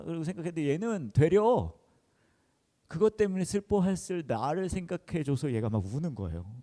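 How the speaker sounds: fighting back real tears while telling it; voice breaking in tears. Real, not acted. Pastor telling the congregation about his daughter, choking up. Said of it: "제가 그때부터 또 울기 시작하는 거예요".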